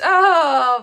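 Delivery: delighted oh. Real, not acted. Delighted reaction to a viewer.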